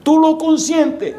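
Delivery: high, drawn-out accusing squeal; stretched, high-pitched complaint; sister's accusation. Acted. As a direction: mimic an indignant sister in a high voice. Sister acted, accusing mother.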